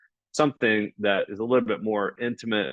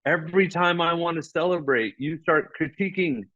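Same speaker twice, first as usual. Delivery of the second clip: exasperated complaint; 7 semitones higher than usual. Retold or imitated. Complaining to Steve that he always critiques, exasperated and raised. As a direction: whine at him that it always happens. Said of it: "And I was like".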